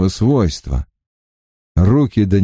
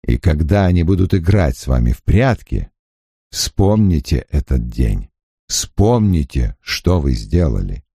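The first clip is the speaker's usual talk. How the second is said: unusually soft; 6 semitones lower than usual. A narrator's reading. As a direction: speak gently, let it sink. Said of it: "необычно мягким голосом".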